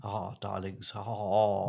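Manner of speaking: a hint of a grand old theatre actor; thespian voice. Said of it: "that's my impression of, of a thespian".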